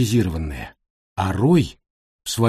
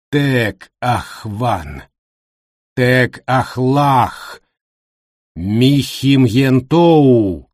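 slow guttural chant, building louder; eldritch horror; 3 dB louder, much slower than usual. Acted. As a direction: intone it like a demon. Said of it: "изрекает гортанный голос".

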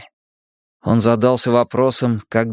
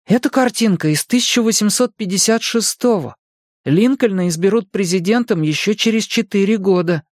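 put-on much higher-pitched boy's voice; eager boy Mike; 8 semitones higher than usual. A narrator's reading. Mike shows his friends an old picture, high boyish explaining.

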